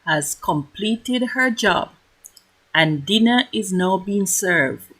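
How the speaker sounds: grand announcer narration; announcer voiceover. A narrator's reading. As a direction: announce the feast like a host.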